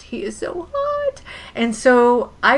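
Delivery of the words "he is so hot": high, slow swoony sigh of desire; swooning fan. Pretend. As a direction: melt over him like a starstruck teen. Swooning over Bo Hopkins.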